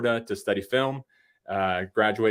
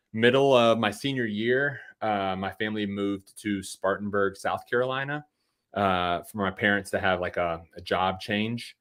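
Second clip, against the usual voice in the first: ordinary talk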